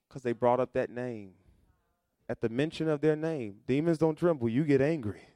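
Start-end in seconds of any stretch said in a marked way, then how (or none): none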